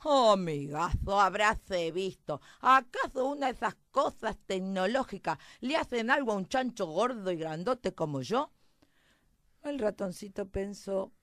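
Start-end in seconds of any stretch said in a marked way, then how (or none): 0.0-8.5 mocking pig. Big fat pig making fun of the mouse, mocking. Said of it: "se empezó a reír de un modo".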